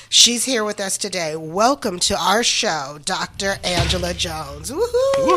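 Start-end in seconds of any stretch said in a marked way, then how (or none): 4.7-5.4 excited cheer. Real, not acted. Cheering the guest's welcome.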